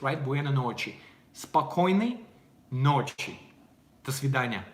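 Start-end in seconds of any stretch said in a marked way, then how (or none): 1.3-3.4 "Спокойной" rising, pause, then "ночи" falling; teaching the phrase. Shown as a demonstration. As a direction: say "Спокойной ночи" word by word for learners, lifting then dropping. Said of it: "It's like buena noches".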